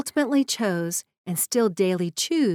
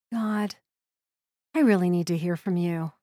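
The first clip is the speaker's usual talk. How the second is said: hushed at first, then rising higher and more urgent with each question; pleading prayer; 3 semitones higher than usual. Retold or imitated. Grieving mother at car seats praying to God, pleading. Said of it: "I said a short prayer".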